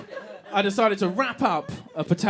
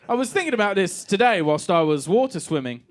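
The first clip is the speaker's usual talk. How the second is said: normal talking voice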